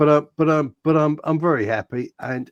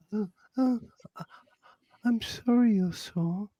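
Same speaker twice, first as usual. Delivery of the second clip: mock sympathy; 8 semitones higher than usual. Mocking a sore player's coddling, sarcastic fake sympathy. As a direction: coo over him in fake pity.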